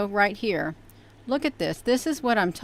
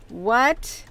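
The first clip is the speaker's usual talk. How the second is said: ordinary talk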